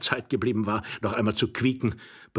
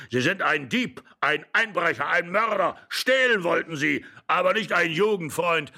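put-on old man's higher-pitched accusations; indignant raised voice; angry shouting; 6 semitones higher than usual. A narrator's reading. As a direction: accuse him point by point, irate. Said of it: "schrie der Alte und stampfte zornig".

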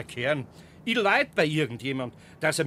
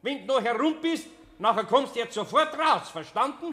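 stern scolding; 7 semitones higher, 4 dB louder than usual. Acted. Scolding the hidden goblin.

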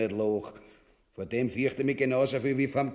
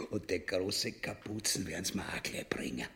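hushed conspiratorial whisper; 8 semitones lower, 10 dB quieter than usual. Acted. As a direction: whisper it so nobody overhears.

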